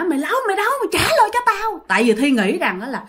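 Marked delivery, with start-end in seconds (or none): 0.0-1.8 high angry yelling; angry demand. Retold or imitated. Thi yelling at the demon to return her pig, angry high shout. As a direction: demand it back, fuming. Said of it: "Thi rất là dữ".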